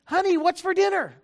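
calling out. Acted. Doctor acting out the husband calling from the door. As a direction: call it out across the house. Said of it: "peek your head in and say".

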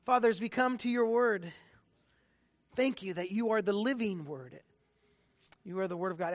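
soft, hushed opening prayer. Acted. Pastor to the congregation, hushed prayer voice. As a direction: pray it low and gentle before the sermon.

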